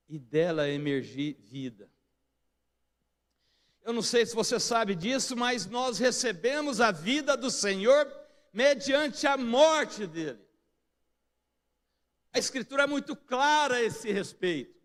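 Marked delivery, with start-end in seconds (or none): 3.8-14.8 higher, louder pulpit delivery; impassioned preaching. Real, not acted. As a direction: preach it with force, voice lifted. Preaches at full force.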